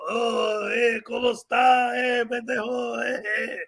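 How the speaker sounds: hammed-up Mexican accent, drawn-out ending; Cheech. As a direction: do a stretched-out Chicano stoner accent. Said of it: "No, I'm doing Cheech".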